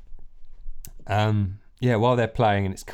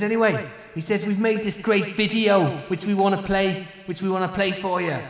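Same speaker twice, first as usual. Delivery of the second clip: frontman on the mic; 9 semitones higher than usual. Acted. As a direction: announce it to the crowd. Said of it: "And he says".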